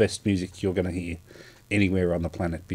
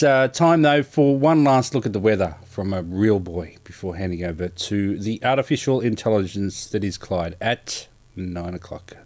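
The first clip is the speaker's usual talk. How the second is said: ordinary delivery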